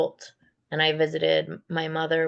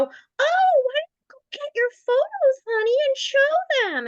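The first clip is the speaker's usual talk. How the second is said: much higher, sugary-sweet mock mother voice, slowing on the end; mimicking Hillary; 6 dB louder than usual. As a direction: put on a fake-sweet mom voice showing off to guests. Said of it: "Hillary would go".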